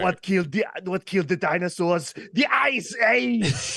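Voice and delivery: Schwarzenegger as Mister Freeze. Acted. Quoting the film's pun, hammed-up villain shout. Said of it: "Schwarzenegger's showing up".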